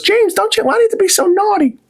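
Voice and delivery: very high mock mom voice; mom scolding. Mom in the kitchen scolding her son James, high and prim. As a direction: squeak it like a prim scolding mother. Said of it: "And she goes".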